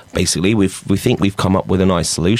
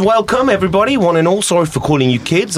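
peppy teacher; 11 semitones higher than usual. Acted. Improv teacher welcomes older students in a high eager voice. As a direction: greet the class like an over-eager teacher.

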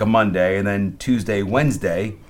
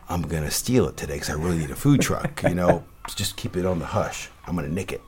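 lowered, hushed retold confession; conspiratorial; 5 semitones lower, 5 dB quieter than usual. Chef confiding truck theft plan to a stranger, conspiratorial. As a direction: drop low and soft, letting someone in on a scheme.

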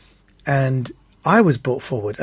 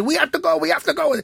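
very high frantic urging; mock panicked urging; 14 semitones higher than usual. Passengers urging the driver on, very high frantic.

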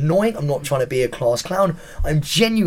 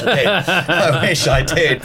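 laughing; 3 dB louder than usual. Real, not acted. Contestant joking about Strictly Come Dancing, laughing. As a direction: say it through laughter. Laughs at his own joke.

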